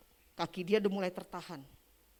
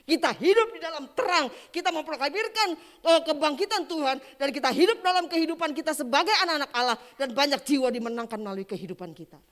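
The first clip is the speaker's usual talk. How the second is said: loud, high, ringing proclamation; full-force preaching; 8 semitones higher, 12 dB louder than usual. Real, not acted. Preacher proclaiming resurrection life to believers, full-force preaching. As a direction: proclaim it to the whole congregation.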